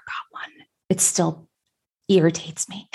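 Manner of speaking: breathy half-whisper, joking aside; playful whispered confession. Real, not acted. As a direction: drop to a whisper for a private gripe. Whispered aside about rock tumbler.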